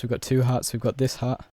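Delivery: ordinary delivery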